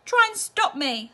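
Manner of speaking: defiant wail. A narrator's reading. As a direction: dare him, queasy. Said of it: "said Missus T V".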